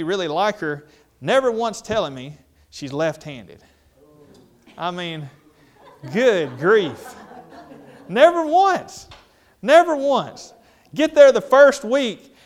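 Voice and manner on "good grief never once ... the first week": loud, high-pitched indignant rant; exasperated outburst. Acted. Comedian griping to the crowd about his left-handed hire, exasperated. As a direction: cry it out in comic outrage. Mock outrage at being misled.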